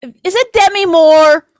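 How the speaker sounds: hammed-up eager husband questions; mock excited wondering. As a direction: ham up a husband sure he knows the actress. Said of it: "be like".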